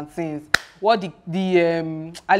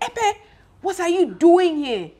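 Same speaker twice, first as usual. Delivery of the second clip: much higher, sharp calling of the name; startled Queen Mother; 11 semitones higher, 4 dB louder, slower than usual. Retold or imitated. Queen Mother finding Epe on the throne, high startled challenge. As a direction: catch him red-handed, voice shooting up.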